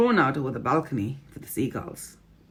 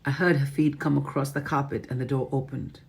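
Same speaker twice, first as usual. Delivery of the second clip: plain reading voice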